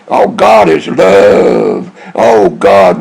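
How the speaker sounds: drawn-out, mock-reverent swoon; mock piety. Man mocking believers and TV evangelists, in drawn-out mock piety. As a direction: stretch the words out in sanctimonious mockery. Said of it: "you think".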